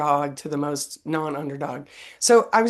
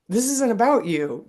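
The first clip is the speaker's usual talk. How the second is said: firm, higher mock lecture to a kid; mock stern parent; 5 semitones higher, 3 dB louder than usual. Mother imagining a talk in the car, mock stern. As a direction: lay down the law, half in jest.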